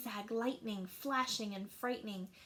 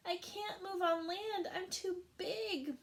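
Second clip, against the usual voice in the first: moaning whale; 8 semitones higher than usual. Acted. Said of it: "moaned the whale".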